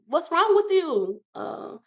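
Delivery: high, slow, worried husband; a scared husband pleading with his wife; alarmed husband. Retold or imitated. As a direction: test whether she recognizes him. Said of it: "he's shaking me".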